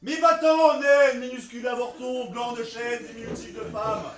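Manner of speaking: high, loud contemptuous yell; furious shout. Acted. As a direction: shout her away in contempt. Lysander insults Hermia in quarrel.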